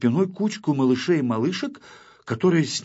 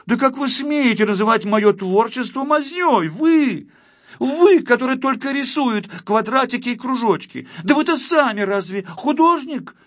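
loud, high-pitched indignant shouting; heated quarrel; 13 semitones higher, 6 dB louder than usual. A narrator's reading. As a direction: trade outraged accusations at the top of the voice. Acted heated quarrel between artists. (гневная перепалка, на крике)

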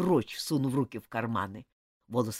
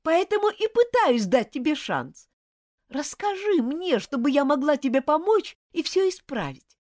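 pleading shout; 7 dB louder than usual. Acted. Girl pleading with angry friend.

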